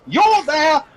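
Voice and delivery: high, loud room assignment; exasperated serving girl calling out; brusque barmaid. Acted. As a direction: dispatch a guest to a room impatiently. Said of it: "She goes".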